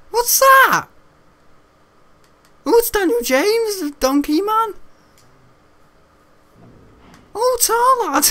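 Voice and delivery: very high, loud cartoonish mimicry; hammed-up piercing impression; silly squealed voice. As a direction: squeal it in a put-on voice. Acted silly voice in retold story.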